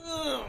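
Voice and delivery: a high, mock groan of dismay; high ugh groan. Hearing mom told a guy she's a comic, groaning. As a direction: groan as if caught out. Reaction to being called a comic.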